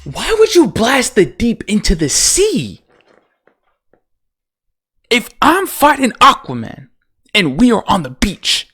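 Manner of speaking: high, incredulous protest; exasperated disbelief. Real, not acted. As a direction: demand an answer to the absurdity. Ranting at character's senseless move.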